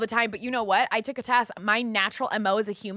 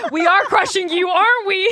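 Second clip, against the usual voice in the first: loud, high crowing into laughter; gleeful teasing; 7 semitones higher, 7 dB louder than usual. Acted. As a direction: gloat playfully, breaking up laughing. Gleeful teasing of a friend's husband.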